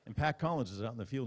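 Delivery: usual speaking voice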